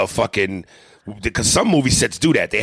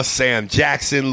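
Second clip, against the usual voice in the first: ordinary delivery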